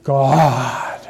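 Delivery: "God" stretched long and weighty; stress on GOD. Shown as a demonstration. Preacher emphasizing God in this verse, "God" held long. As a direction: hold the word "God" out slowly, solemnly. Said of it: "is emphasizing God in this verse".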